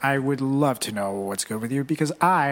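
ordinary talk

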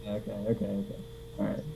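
muffled and far off; into a pillow. Real, not acted. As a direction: voice smothered by a pillow. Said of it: "sounds like you're yelling into a pillow".

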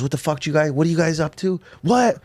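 high, rising mock confrontation; aggressive drunk. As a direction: play the guy puffing himself up, voice climbing. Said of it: "like, aggressive".

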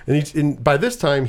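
usual speaking voice

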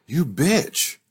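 high, drawn-out mock indignation; mock outrage. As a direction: scold the author in playful outrage. Her outraged reaction to the author.

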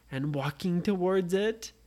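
usual speaking voice

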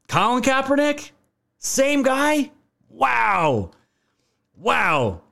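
high, sarcastic feigned astonishment; mock surprise. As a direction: play dumb, as if shocked by the coincidence.

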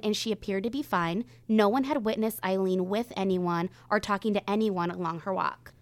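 measured true crime storytelling; suspenseful crime show narrator. Acted. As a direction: tell the case calmly, with weight. Reads the day of disappearance.

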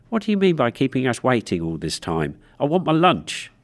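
put-on high, loud, bratty complaint from a girl; stage girl scolding the producer for keeping her waiting, indignant; indignant spitfire demand. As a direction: snap at the producer like a cheeky child. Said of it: "'What a little spitfire,' I said to myself".

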